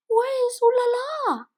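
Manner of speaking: very high, loud panicked question; frantic. Acted. Poodle mother searching for her pup, frantic high cry. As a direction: call out in frantic worry. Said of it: "asked frantically".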